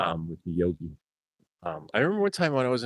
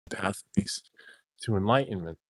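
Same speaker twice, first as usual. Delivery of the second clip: normal talking voice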